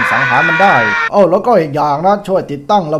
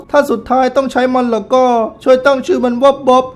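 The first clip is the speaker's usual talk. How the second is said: raised, theatrical middle-aged woman voice; auntie voice; 9 semitones higher, 4 dB louder than usual. Acted. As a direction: play the proud widow auntie, a bit higher. Auntie remembering late husband. (เสียงป้าแบบเล่นละคร สูงขึ้นเล็กน้อย)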